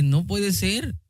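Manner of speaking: understated shock, quoted from memory; retold shocked disbelief. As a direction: lift the pitch in mild astonishment, keeping it soft. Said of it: "con una cara sorprendida".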